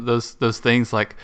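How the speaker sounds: ordinary talk, nothing marked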